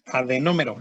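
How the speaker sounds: Adenómero modelled clearly, stress on NÓ; said as written. Shown as a demonstration. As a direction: pronounce Adenómero as spelt, accenting the first o. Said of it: "así como se escucha tal cual".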